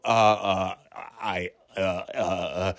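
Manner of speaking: low, quiet stalling student; flustered hesitation. Retold or imitated. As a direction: mumble softly, hunting for an answer. Caught student has no answer.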